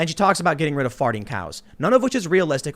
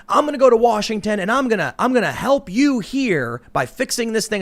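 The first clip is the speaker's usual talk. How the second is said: mock a candidate pledging to voters; put-on stump-speech voice, pitched up; politician's promise; 8 semitones higher, 3 dB louder than usual. Mimics politician's campaign promise.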